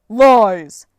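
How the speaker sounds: explosive, very loud denial; roaring accusation; angry giant's shout. Acted. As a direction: bellow it to drown him out.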